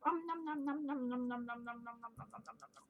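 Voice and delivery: munch away like friends eating cookies, trailing off; pretend munching. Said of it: "Let's pretend my friends came and they all ate one".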